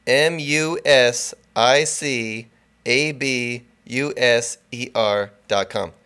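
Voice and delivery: letters spelled slowly one by one. Shown as a demonstration. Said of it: "Just in case".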